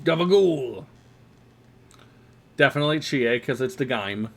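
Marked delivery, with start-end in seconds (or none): 0.0-0.9 Gabagool! in a mock New Jersey drawl; a quick wiseguy Jersey Gabagool!; New Jersey accent. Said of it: "the New York and the New Jersey accent".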